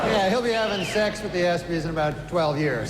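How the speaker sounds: loud, brash stand-up host working the crowd; awards host monologue. Acted. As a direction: play to the room like an awards show host. Stand-up host monologue, louder, announcer.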